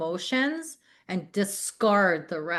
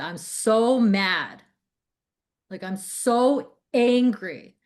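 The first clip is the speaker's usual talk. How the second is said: retold anger, slow and emphatic; angry; 4 semitones higher, 6 dB louder, much slower than usual. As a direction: stretch out the frustration, higher and louder. Retells own anger to coach.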